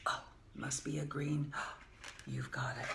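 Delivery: ordinary delivery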